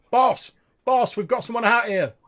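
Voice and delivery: high, loud shout into the cave; guard shouting a warning to his boss; calling out. Acted. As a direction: yell for the boss inside.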